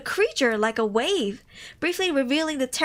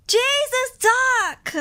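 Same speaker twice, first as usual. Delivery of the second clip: high loud cry of Jesus, Doc; real shock bursting out; shocked outcry; 11 semitones higher, 5 dB louder, much slower than usual. Real, not acted. Streamer reading horror story cries out in shock. Reader reacts to parasite reveal.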